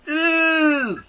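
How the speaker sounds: very high-pitched playful squeal; gleeful squeal. Pretend. Host cheers the number one show, gleeful squeal. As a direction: squeal it in mock delight. Mock-excited echo of co-host.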